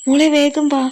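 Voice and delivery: high, loud, frightened call; panicked shout. A narrator's reading. Mother summons daughter as father falls ill, panicked shout. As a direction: cry out urgently for help. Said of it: "പരിഭ്രമം നിറഞ്ഞ ശബ്ദം".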